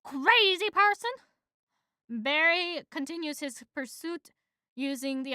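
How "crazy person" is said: high, loud road-rage yell; loud, high angry driver shout; yelled insult. Acted. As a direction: shout at the cyclist. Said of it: "One driver yells at messenger".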